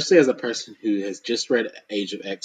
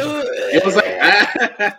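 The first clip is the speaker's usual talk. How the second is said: slow laughing words; 8 semitones higher, much slower than usual. Real, not acted. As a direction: laugh through it. Laughing while speaking.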